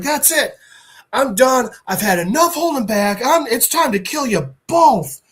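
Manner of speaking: lightly hammed-up villain at his limit; acted fed-up threat. Villain threatens both heroes, mildly acted fed-up anger.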